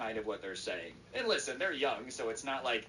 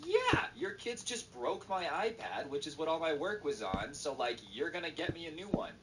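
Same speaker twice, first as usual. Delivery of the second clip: mock indignation; 6 semitones higher than usual. Cousin demands a new iPad from mom, mock indignation. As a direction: press the demand in a raised pitch.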